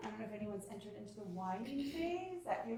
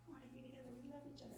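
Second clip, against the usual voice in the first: put-on soft kids' voices; 4 semitones higher, 16 dB quieter, much faster than usual. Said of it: "They're like".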